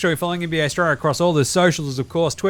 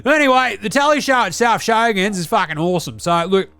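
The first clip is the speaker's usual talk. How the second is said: Deli character voice; 2 semitones higher than usual. Acted. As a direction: play the crude Aussie uncle reviewing telly. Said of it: "It's your old man Deli over here".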